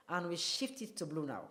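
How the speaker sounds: ordinary talk, nothing marked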